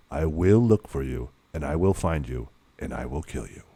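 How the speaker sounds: low, measured, quiet menace; film hero's speech. Acted. Film father warning his daughter's kidnapper, low threat. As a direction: recite the threat low and controlled, sinking at the end. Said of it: "and he does the speech".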